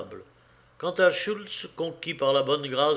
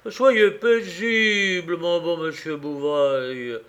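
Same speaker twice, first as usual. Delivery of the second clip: slow, soothing mock-calm, opening higher; preaching calm; 4 semitones higher, 5 dB louder, slower than usual. A narrator's reading. Lavarède preaching calm to the furious moneylender, slow and soothing. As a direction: lull him with exaggerated patience. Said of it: "en lui prêchant le calme".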